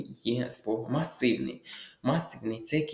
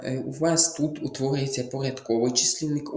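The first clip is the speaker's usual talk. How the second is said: normal talking voice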